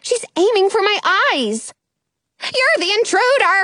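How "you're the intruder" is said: high, accusing bird voice; yelling pigeon. A narrator's reading. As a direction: screech an accusation at intruders. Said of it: "the pigeon yells".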